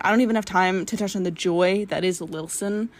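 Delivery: ordinary delivery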